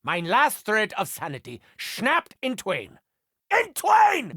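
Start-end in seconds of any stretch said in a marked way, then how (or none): 3.5-4.3 manic shout. Acted. German doctor losing his sanity, manic shout. Repeats the phrase, now shouted.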